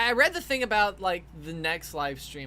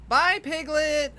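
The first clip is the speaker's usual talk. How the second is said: fond goodbye; 11 semitones higher, 9 dB louder, slower than usual. Real, not acted. Saying bye to a pet.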